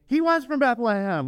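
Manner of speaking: high, insistent blurt; eager correction. Retold or imitated. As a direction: burst in to correct them. We want to tell them.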